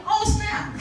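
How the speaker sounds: high, thrilled "Oh, snap"; excited yelp. Retold or imitated. Author answering her editor's call, high and thrilled. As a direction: hit by the big news, squeal it out. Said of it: "I started yelling in my room".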